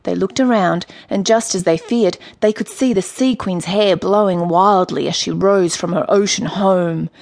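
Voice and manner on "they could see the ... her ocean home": usual speaking voice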